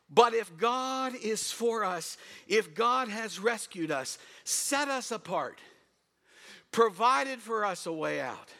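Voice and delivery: normal talking voice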